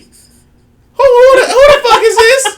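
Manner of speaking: very high, loud, cracking up; laughing outrage. Retold or imitated. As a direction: shriek it through laughter in mock disbelief. Laughing, retells shock at the spirit.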